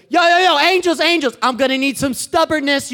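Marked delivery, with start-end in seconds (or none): 0.1-1.4 eager, raised call for attention; excited hailing. Acted. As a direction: hail the crew with urgency. God hailing the angels excitedly.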